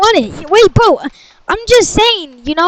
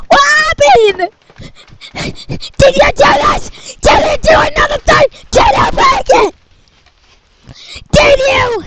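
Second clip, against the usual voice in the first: higher, rising angry interrogation; angry parent; 6 semitones higher than usual. Acted. Parent confronting son after the PC breaks, higher angry grilling.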